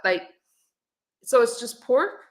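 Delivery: ordinary delivery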